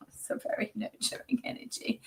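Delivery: soft laughing. Real, not acted. Psychic moved during reading.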